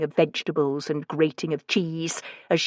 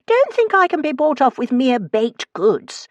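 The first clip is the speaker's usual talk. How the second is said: lofty; 9 semitones higher, 4 dB louder than usual. A narrator's reading. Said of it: "I told him loftily".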